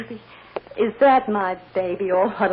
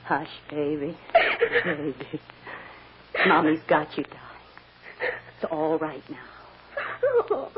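soothing; 4 semitones lower, slower than usual. Acted. Mother soothing her crying child.

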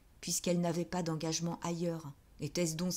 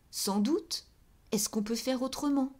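cried out; 6 semitones higher, 4 dB louder than usual. Acted.

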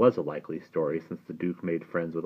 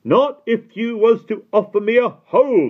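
high, loud angry gatekeeper; furious loud refusal; 13 dB louder than usual. A narrator's reading. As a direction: snap it at the silent boy. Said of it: "the man said angrily".

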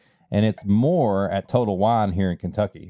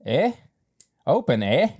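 playful Canadian "eh?" rising at the end; Canadian accent; 6 semitones higher than usual. Pretend. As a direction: tack on a questioning Canadian "eh?". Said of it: "Yeah, the accent".

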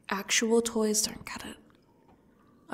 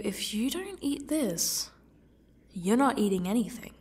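usual speaking voice

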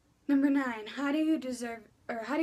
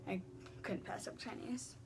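whispered confession; 10 dB quieter than usual. Real, not acted. Confiding food choice.

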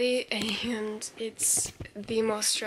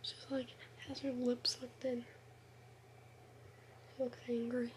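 soft unvoiced whisper; whispering over a crib; 6 dB quieter than usual. Real, not acted. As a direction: keep it hushed by the crib.

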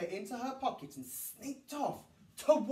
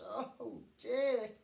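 slow, defeated groan; tired mum groans at the children. A narrator's reading. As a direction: sag under it all. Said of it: "Missus Large groaned".

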